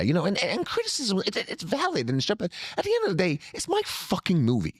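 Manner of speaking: high, animated Tarantino voice; a quick, high impersonation of Tarantino; Tarantino impression. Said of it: "without doing the voice".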